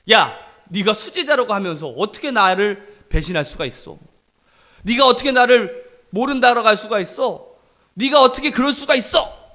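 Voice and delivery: imitated outraged accusation, rising in force; mock scolding. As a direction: act out an indignant telling-off. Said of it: "혼내지 않으셨어요".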